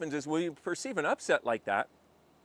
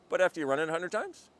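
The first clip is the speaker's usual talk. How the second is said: ordinary talk, nothing marked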